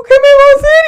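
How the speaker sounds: hammed-up wailing plea, very high and loud; mock-sob loudly in a high-pitched panic; llanto imitado. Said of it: "Y me solté a llorar". (llanto exagerado)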